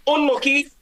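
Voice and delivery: high-pitched imitated call; soccer sideline call. Soccer player consoling a teammate, high imitated call. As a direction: sing out high, as if from the touchline. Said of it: "a very soccery thing to say".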